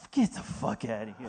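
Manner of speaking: low, hushed disbelief; scornful dismissal. Acted. Acted ticket seller's scornful dismissal.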